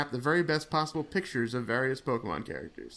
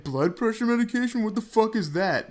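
incredulous stranger; 9 semitones higher, 5 dB louder than usual. Retold or imitated. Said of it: "they went".

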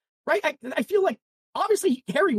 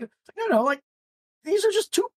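ordinary delivery

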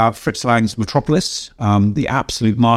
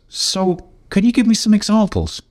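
quoting Ron; 10 semitones higher than usual. Retold or imitated. Ron asking, leaning forward.

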